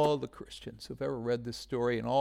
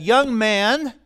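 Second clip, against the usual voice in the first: loud, higher call to the dead; commanding call; 7 semitones higher, 10 dB louder than usual. Retold or imitated. Jesus calling the dead young man, loud command. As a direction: call him out with authority. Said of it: "Jesus said".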